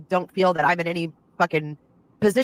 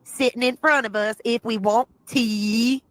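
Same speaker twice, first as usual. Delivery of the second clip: higher, slow mock Southern drawl; put-on Southern accent; 4 semitones higher, slower than usual. Streamer mocking Southern accents for her chat, slow, higher put-on Southern accent. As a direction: stretch every word out in an exaggerated Southern accent. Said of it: "What the hell kind of accent is she trying to use".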